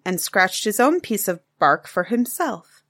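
ordinary delivery, nothing marked

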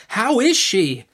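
high, insistent demand; exasperated aunt, pitched high; sharp. A narrator's reading. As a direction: press for an answer impatiently. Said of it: "said my aunt sharply".

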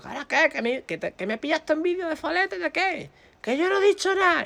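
high-pitched retold outrage; indignant yelling. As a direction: yell the accusation back through the door. Shouted argument through locked door.

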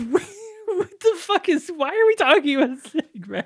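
high-pitched, laughing incredulity; mock bafflement, voice pushed high; exasperated disbelief. Podcaster reacting to pointless snake talk, exasperated.

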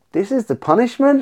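high, baffled "this is the punishment"; incredulous question. Retold or imitated. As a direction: sell the outrage that a rest counts as punishment. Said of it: "and I'm like".

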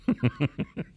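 brief laugh after own joke; short chuckle. Real, not acted. Host after his Jeopardy jab at Olbermann, short chuckle. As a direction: chuckle at your own comeback.

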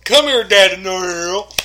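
mock calling to dad. Tells host how to call dad.

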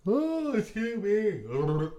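high, very slow mock-polite apology. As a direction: draw it out in fake politeness. Playful mock-polite aside.

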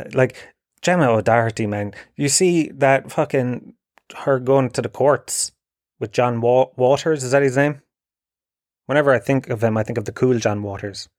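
normal talking voice

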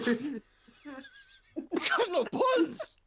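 very high, slow squeal of disbelief; incredulous. Retold or imitated. Repeating it to the cashier, a very high slow squeal. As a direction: drag out buns in outrage. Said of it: "I said".

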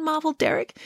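plain reading voice

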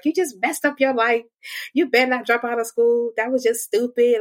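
a hint of a disapproving mother; mom scolding. Mom scolding her, voiced.

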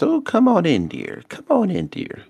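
put-on high sing-song old lady. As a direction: welcome them in sweetly. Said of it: "she is down in her little".

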